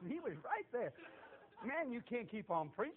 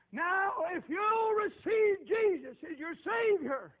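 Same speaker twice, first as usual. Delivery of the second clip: high, loud, very slow invitation; loud, slow, high altar call; altar call shout; 11 semitones higher, 12 dB louder, slower than usual. Retold or imitated. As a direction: summon him forward with full voice. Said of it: "yelling and hollering at him".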